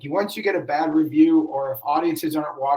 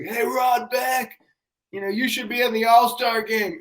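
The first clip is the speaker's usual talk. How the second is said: retold heckler yell; 7 semitones higher than usual. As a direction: imitate a fan calling from the bleachers. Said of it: "somebody yells like".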